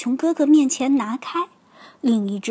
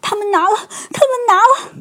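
put-on high, worked-up child's outcry; upset little bear voice; too angry to speak; 8 semitones higher, 4 dB louder, faster than usual. A narrator's reading. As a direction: blurt it out high, louder the second time. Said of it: "气得说不出话来".

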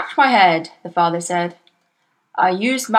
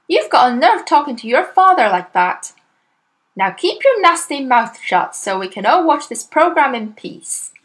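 loud, high telling-off; scolding mother; 6 semitones higher, 5 dB louder than usual. A narrator's reading. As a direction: snap at the girl to shut up.

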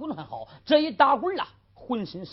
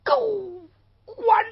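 带着哭腔高声怒骂; 恨到极点，咬着牙骂出口; 咬牙切齿地骂; 7 dB louder than usual. Acted. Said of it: "咬牙切齿骂道". (徐文彪醒来骂狗官，咬牙切齿)

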